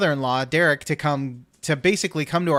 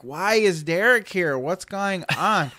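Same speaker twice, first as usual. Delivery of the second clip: slow, higher, stretched-out questions; retold exasperated bafflement; 4 semitones higher, slower than usual. Half-awake husband asking about the visitor, exasperated. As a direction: drag the questions out in mock exasperation.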